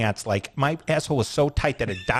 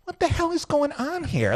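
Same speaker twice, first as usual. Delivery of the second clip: higher, incredulous outburst; exasperated disbelief. Retold or imitated. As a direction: throw the question up in disbelief. Retold exasperation at the cliff.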